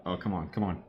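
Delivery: usual speaking voice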